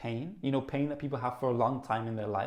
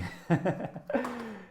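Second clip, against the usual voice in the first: laugh; 8 semitones higher than usual. Real, not acted. Laughs at own rambling.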